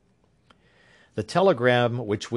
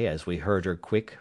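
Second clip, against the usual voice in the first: usual speaking voice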